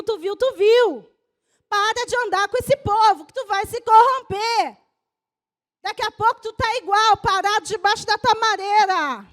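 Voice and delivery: loud, driving sermon climax; fiery preaching. Real, not acted. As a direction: preach it hard to the church. Pastor preaching at full force. (pregação inflamada e forte)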